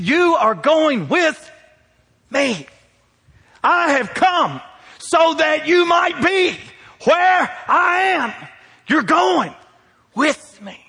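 slow, high, booming proclamation; voicing Jesus, shouted. Preacher voicing Jesus to the congregation, slow and shouted. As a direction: declare it like Jesus calling his people. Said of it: "He said".

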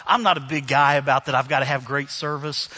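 normal talking voice

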